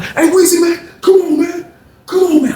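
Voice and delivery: high, loud urgent shout; calling out for help. Retold or imitated. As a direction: yell across the cell for your friend. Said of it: "you see [name] like".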